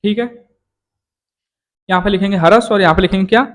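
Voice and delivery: usual speaking voice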